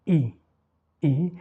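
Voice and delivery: isolated "I" as a pure ee; crisp teacher's model of Italian "I"; demonstrated vowel I. Shown as a demonstration. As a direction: say "I" with lips well stretched. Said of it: "les lèvres sont bien étirées".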